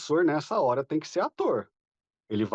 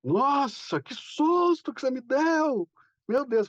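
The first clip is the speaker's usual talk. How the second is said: much higher, mock-scared teacher; teasing the class with a mock scare, higher; joking mock fright; 10 semitones higher, slower than usual. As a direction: play it up as a hammed-up fright. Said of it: "eu brinco assim também".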